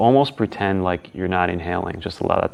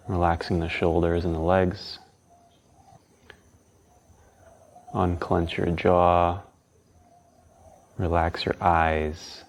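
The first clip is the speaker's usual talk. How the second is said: calm, unhurried guiding tone; guided meditation; 5 dB quieter than usual. Acted.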